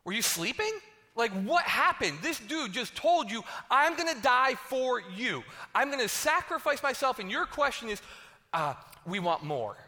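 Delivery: can't believe what they just asked; preacher scolding the disciples in disbelief; incredulous disbelief. Retold or imitated. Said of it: "you're going".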